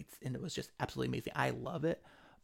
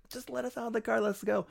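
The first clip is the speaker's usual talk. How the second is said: pitched up, urgent and impatient; exasperated high plea; 10 semitones higher than usual. Retold or imitated. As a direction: beg to be let out, voice jumping higher. Retold demand at airport checkpoint.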